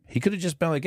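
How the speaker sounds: usual speaking voice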